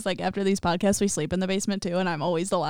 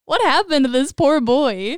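slow, higher, exclaimed question; loud dismay; 6 semitones higher, 7 dB louder, slower than usual. Real, not acted. Host laments the story's poor boy, loudly dismayed. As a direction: cry out in drawn-out disbelief.

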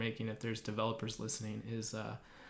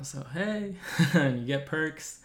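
laughing; 5 semitones higher, 7 dB louder than usual. Real, not acted. Cheerful sponsor pitch.

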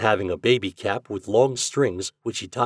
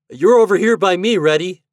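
put-on high, loud boyish announcement; announcing; 10 semitones higher, 9 dB louder than usual. A narrator's reading. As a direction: direct a friend to his place. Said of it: "announced Toad".

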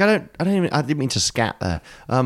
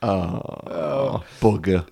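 James Bond voice; 6 semitones lower, 4 dB quieter, much slower than usual. Retold or imitated. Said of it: "That's how Bond sounds".

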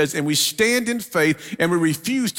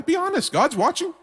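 playful teasing; 13 semitones higher than usual. Real, not acted. Teasing the congregation.

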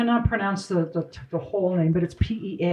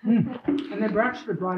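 talking with food in mouth; words round a bite of chocolate; mouth full; 3 semitones higher than usual. Real, not acted. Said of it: "I'm talking with food in my mouth".

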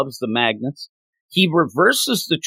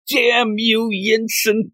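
much higher, hammed-up cry of rage; mock curse; 9 semitones higher, much slower than usual. Tony cursing Yinsen, hammed-up high outcry. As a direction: shake your fist at the sky.

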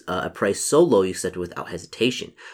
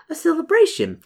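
much higher echo of "celebration"; trailing-off echo; 6 dB louder than usual. Acted. May musing to her guest about the festival, trailing-off echo. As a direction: repeat "celebration" in a high, drifting voice. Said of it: "She trails off for a moment".

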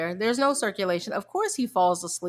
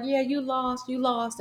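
higher-pitched imitation of an excited winner; lightly mimicked excitement, pitched up; excited gloating; 5 semitones higher than usual. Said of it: "he was so excited".

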